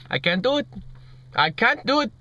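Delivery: mock Indian accent, giving up on air; hammed-up Indian-accented newsreader meltdown; [name], Indian version. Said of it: "That was an Indian version of it".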